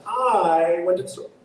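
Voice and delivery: "I" stretched high, the rest falling away; over-stress the pronoun "I". Shown as a demonstration. Director mimicking actors who wrongly punch "I". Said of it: "actors do that all the time".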